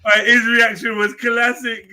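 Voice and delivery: laughing while talking; words broken by laughter. Real, not acted. Laughing recap of the clip.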